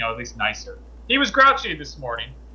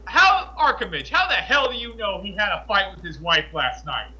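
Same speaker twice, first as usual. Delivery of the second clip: high-pitched, incredulous mock shout; retold loud, disbelieving question; imitated loud outburst; 3 semitones higher than usual. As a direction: blurt it out for the whole class, starting high. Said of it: "as loud as he can".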